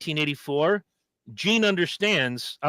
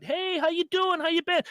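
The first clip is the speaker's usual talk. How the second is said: much higher, cheery hello; friendly greeting. Retold or imitated. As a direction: greet an old pen pal warmly. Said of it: "Eric comes out".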